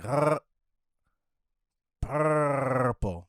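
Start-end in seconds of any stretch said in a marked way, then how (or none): 0.0-0.4 "Rrr" as a throaty trill; rolled r. Retold or imitated. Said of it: "But you're going like".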